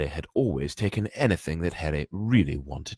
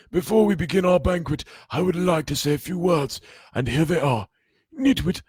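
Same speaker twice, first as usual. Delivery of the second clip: higher, louder, grand welcoming speech; jolly Dumbledore; 10 semitones higher, 5 dB louder than usual. Acted. Dumbledore welcoming students, grand and cheerful. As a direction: beam at the students as you welcome them.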